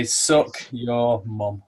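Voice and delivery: slow, drawn-out cheeky insult; cheeky retort. Retold or imitated. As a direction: drag it out as a rude comeback. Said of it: "I was gonna reply".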